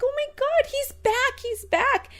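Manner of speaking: high-pitched, thrilled reunion squeal; overjoyed. Retold or imitated. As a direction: re-enact giddy joy at a pet's return. Retold joyful reunion with dog.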